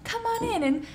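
inviting. Acted. Friend imitating her approach to veganism, sweetly inviting. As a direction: welcome them in, playfully high. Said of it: "like, so inviting".

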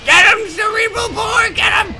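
loud, high cheering; excited shout. Real, not acted. Gamer egging on his gun, high excited cheer. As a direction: cheer the weapon on.

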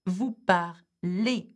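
"Vous parlez" drawn out slowly and clearly; slow model. Shown as a demonstration. Teacher modelling the vous form for students, slowly. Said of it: "teacher modelling the vous form".

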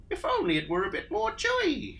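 wistful high sigh; sighing Emu. Acted. Emu sighs over the stew, high voice. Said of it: "she sighed".

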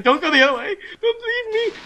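high, loud frightened plea; scared pleading. Real, not acted. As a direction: beg in panic.

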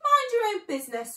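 high, loud retort; outraged rat's outburst; angry shriek. Acted. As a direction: shriek it back indignantly. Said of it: "shrieked the Rat".

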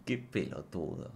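deadpan sigh of disdain; unimpressed murmur. Shown as a demonstration. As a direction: mutter it with weary contempt. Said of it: "así se lo digo".

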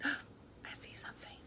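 gasp, then a soft whisper; hushed aside. A narrator's reading. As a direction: whisper it like a discovery. Reader's hushed aside over the picture.

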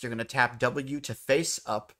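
ordinary talk

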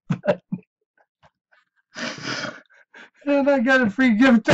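high, loud words squeezed through laughter; real helpless laughing. Real, not acted. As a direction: struggle through the sign-off while cracking up. Breaking up laughing during sign-off.

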